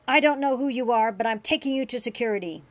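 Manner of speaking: loud, high angry threat. A narrator's reading. Old man seizing Bashir by wrist, loud angry threat. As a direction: haul him off to security.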